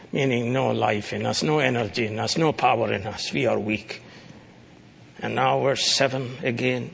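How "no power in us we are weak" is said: ordinary talk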